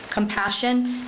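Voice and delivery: normal talking voice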